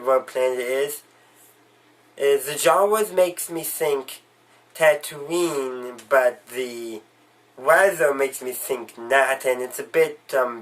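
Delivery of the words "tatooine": normal talking voice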